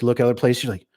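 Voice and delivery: usual speaking voice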